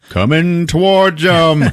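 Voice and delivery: high, slow, drawn-out announcement; mock ominous build-up. Storyteller describes a star coming closer, mock dramatic. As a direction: stretch it out as something looms closer. Star looming toward the couple.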